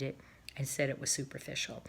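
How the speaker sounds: hushed whisper; whispered aside. Acted. Cob confiding to his wife, whispered. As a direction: drop to a whisper.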